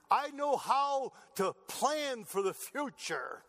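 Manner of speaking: lightly voiced proud job applicant showing off; arrogant boasting. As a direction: brag like a self-made man selling himself. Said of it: "answered it arrogantly, proudly".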